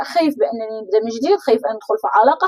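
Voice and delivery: ordinary talk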